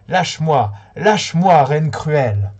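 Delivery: loud, rising plea lâche-moi, lâche-moi; pleading cry. A narrator's reading. Amundus pleads with the cruel queen to release him. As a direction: beg the cruel queen to let go. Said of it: "Amundus a imploré".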